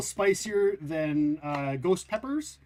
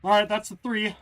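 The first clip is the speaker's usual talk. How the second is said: ordinary talk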